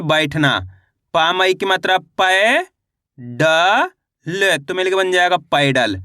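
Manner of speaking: slow syllable-by-syllable drilling, then each whole word; slow reading drill. Shown as a demonstration. Teacher drilling Hindi words for young learners.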